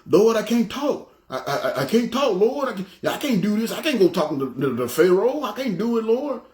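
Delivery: high, laughing, half-hammed plea of a scared Moses; mock pleading Moses. Moses begging God to spare him from Pharaoh, high laughing plea. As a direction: whine it up high like a nervous man begging off.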